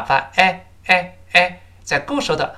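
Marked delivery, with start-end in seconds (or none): 0.3-1.6 "哎" as three separate short eh sounds; vowel of CARE. Shown as a demonstration. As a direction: repeat a half-open eh, mouth not too wide. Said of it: "口型不能太大".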